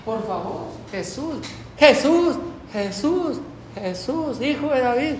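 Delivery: a mother pleading, from a cry to a soft beg; crying out for help. Retold or imitated. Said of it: "Dime qué viene gritando". (madre suplicando a Jesús: de grito a ruego suave)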